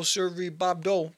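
ordinary talk, nothing marked

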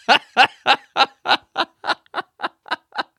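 high, loud, long burst of laughter; real laughing fit. Real, not acted. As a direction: crack up helplessly at your own story. Cracking up at own story.

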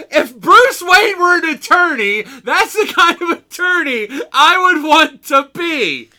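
high, slow screamed comic rant; Sam Kinison. Acted. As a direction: belt it out in drawn-out mock outrage. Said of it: "I started getting half Sam Kinison".

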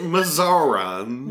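Missourians drawn out slowly, mizz-OR-uns; alien-sounding Missourians. Pretend. Cohost mocks the local Missourians pronunciation slowly. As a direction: stretch Missourians like a strange name. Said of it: "You sound like an alien".

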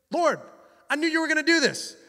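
high-pitched mock-angry complaint; Jonah, angry. Prophet blaming the Lord, acted anger. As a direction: complain angrily up at God. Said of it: "he becomes angry".

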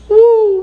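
high loud woo of joy; ecstatic whoop. Real, not acted. Preacher rousing the congregation, loud high whoop. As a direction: cry out in praise.

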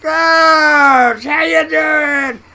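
shouted high and loud, slow on the question; booming greeting. Retold or imitated. The giant crewmate greets the new cook with a booming shout. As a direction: bellow a big friendly hello.

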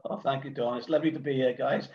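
normal talking voice

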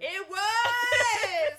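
loud real laugh through the words; laughing agreement. Real, not acted. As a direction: burst out laughing as you agree.

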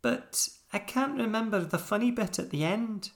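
high-pitched upset toy voice; high, plaintive little story-character voice. A narrator's reading. Pickle fretting over forgotten joke, upset high little voice.

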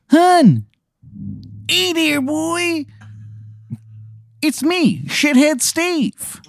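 high, exaggerated Maryland accent; worst Maryland accent. Stranger calls after leaving player in bad Maryland accent. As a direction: call out in an awful Maryland accent. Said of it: "the worst Maryland accent".

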